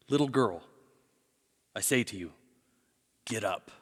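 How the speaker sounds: put-on soft, low, unhurried words to a child; tender command. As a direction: gently call her to rise, with pauses. Jesus gently raises the dead girl.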